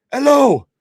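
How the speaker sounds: high, loud phone hello; dad answering phone. Acted. Tater Tart's dad picking up the host's call, loud high hello. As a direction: belt out a high-pitched, drawn-out hello. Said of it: "dad actually answered the phone".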